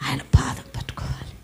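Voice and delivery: hushed, breathy whisper; whispered plea to grasp his feet. Real, not acted. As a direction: drop to a whisper. Sudden hushed line in sermon.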